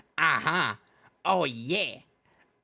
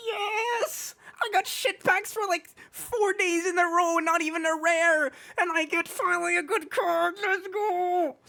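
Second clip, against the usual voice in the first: excited screaming; slower than usual. Real, not acted. Finally pulls a rare card.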